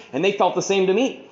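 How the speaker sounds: ordinary talk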